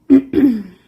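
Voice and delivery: explosive hacking cough; a big loud cough from the lungs; loud chesty cough. Acted.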